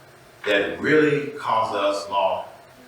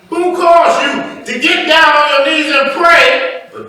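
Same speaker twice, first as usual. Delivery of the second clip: loud, raised-pitch sermon exhortation; preaching at full force; 14 semitones higher, 10 dB louder than usual. Real, not acted. Preacher warning his people against added rules, forceful preaching. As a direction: drive it home to the congregation.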